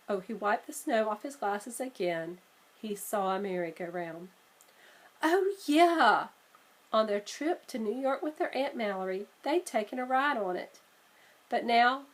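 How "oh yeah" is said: loud, high oh yeah; light up at the memory; happy recognition. Acted. Siblings remembering their ride, gleeful.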